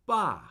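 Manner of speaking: Ba starting high, falling straight down; fourth tone on Ba. Shown as a demonstration. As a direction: drop "Ba" firmly, like a command. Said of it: "I'm starting higher".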